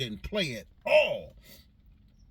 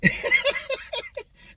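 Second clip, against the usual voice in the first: big laugh; 7 dB louder than usual. Real, not acted. Laughing at own story.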